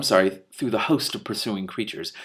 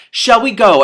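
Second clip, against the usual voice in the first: much higher and louder, ringing out; Death's booming call; 12 semitones higher, 13 dB louder than usual. Acted. Death to the dead wizard, booming and loud. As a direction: announce yourself as Death on a horse.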